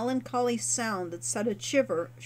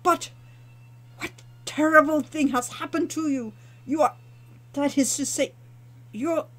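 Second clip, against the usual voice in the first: shocked innkeeper, higher and louder, breaking off; faltering innkeeper; 4 semitones higher, 4 dB louder than usual. A narrator's reading. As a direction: recoil at the smell, then stumble. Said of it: "Shamin faltered".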